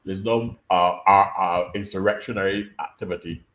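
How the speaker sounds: ordinary delivery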